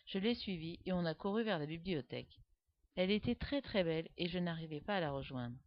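ordinary delivery

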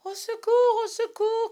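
high, loud panicked cry; crying for help. A narrator's reading. Slightly cries to the boys while fleeing wolves, panicked. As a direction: scream for help in terror. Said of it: "cria-t-il".

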